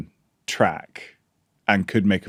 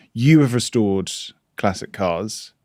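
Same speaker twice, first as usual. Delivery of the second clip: usual speaking voice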